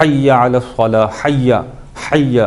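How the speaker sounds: حی strongly pinched in the throat; slow, constricted h in حی; very hard h. Shown as a demonstration. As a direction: squeeze the h of حی as in the adhan. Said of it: "بہت سختی کے ساتھ پڑھتے ہیں".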